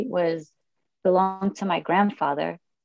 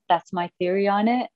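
ordinary talk, nothing marked

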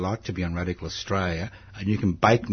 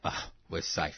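whispered mock relief; mock relief aside; 5 semitones lower, 11 dB quieter than usual. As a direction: breathe it out as a joking aside. Host joking after guest's answer.

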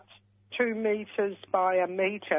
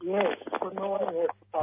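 muffled phone line; phone held away; faster than usual. Real, not acted. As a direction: caller too far from the phone. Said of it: "You're a bit ruffled, uh, muffled, my dear. Can you talk into the phone a little bit closer?".

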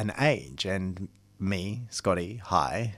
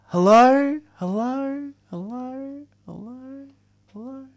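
high call fading away like an echo; high-pitched hello echoing into the distance; 7 dB louder, slower than usual. Acted. Calling into echoing empty body space.

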